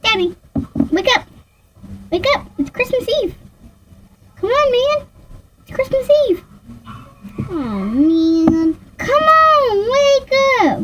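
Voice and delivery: put-on high kid voice, pleading him to wake up; child waking daddy. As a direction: play the little kid tugging at daddy. Doll plays child waking daddy.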